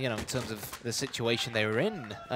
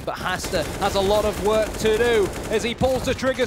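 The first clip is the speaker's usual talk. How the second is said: high, loud esports commentary at full pitch; excited play-by-play; 10 semitones higher, 6 dB louder than usual. Real, not acted. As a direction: ride the action, driving louder and higher. Live excited play-by-play call.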